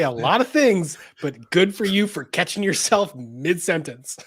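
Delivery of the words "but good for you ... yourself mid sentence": ordinary talk, nothing marked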